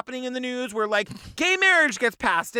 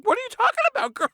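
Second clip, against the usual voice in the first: put-on much higher, camp old barfly voice; 12 semitones higher, faster than usual. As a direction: play the baffled old drunk at the bar, pitched way up.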